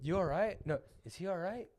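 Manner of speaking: quiet, offhand mock of the actor's line; nonchalant. Mocking an actor's careless line to a son in a quiet offhand tone. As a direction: toss it off like it barely matters. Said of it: "he looks at him so nonchalant and he's like".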